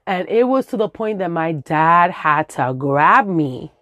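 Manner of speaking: ordinary talk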